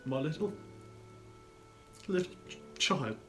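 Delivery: high, broken, halting grief; choked cry. Acted. Father falters naming his lost child, choked up. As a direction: break off and struggle for the word. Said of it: "cried Bob".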